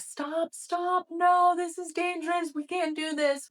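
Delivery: high-pitched, frantic alarm voice; acted-out panic. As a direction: play a nervous system sounding the alarm. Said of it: "my nervous system was freaking out".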